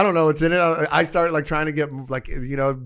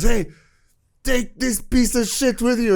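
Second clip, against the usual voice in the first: higher, slow, hammed-up angry boss voice; mock yell from above; 7 semitones higher, slower than usual. Shop owner hurling the broken iPad from his balcony, in a mock slow yell. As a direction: imitate Ben bellowing down at him.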